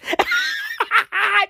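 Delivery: sudden high-pitched laugh. Real, not acted. Comic laughing high at the vaccine news. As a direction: burst into a high cackle.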